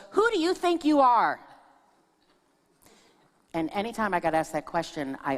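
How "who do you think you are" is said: high, louder scolding challenge; a mother scolding her daughter. Retold or imitated. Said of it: "one of them would look at me and goes".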